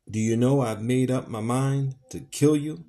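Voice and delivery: rage through teeth. Acted. Legree threatening Tom, raging through his teeth. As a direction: menace him with held-in rage. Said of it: "speaking through his teeth in the paroxysms of determined rage".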